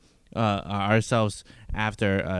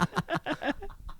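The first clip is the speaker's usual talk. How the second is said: high squeaky laugh; 11 dB quieter than usual. Real, not acted. Laughs at own accent.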